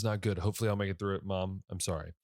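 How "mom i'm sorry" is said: ordinary talk, nothing marked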